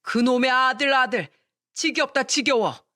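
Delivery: fed-up husband lashing out at his wife; fed-up outburst. Acted. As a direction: snap it out, sick of hearing about sons. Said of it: "지겹다고 화를 내더니".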